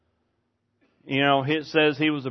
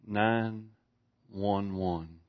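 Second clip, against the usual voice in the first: quiet retold call; 5 semitones lower, 9 dB quieter, much slower than usual. Retells woman's emergency call.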